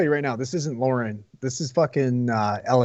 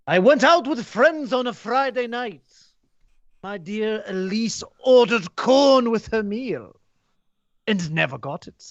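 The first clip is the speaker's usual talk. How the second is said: higher, slow put-on reviewer voice; fake reviewer voice; 5 semitones higher, much slower than usual. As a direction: read it in a prim put-on voice. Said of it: "so you have to do a fake voice?".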